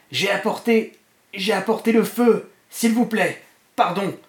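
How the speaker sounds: high, straining voice of a dying fanatic; ecstatic gurgle. A narrator's reading. Dying Trashcan to his master, ecstatic. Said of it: "extatique, gargouillait dans sa poitrine".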